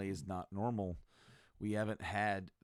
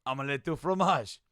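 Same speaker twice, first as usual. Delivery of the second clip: loud, raised password retry; mock insistent shout; 4 semitones higher, 10 dB louder than usual. Retold failed password attempt.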